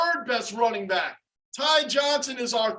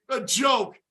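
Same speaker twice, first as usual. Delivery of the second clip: usual speaking voice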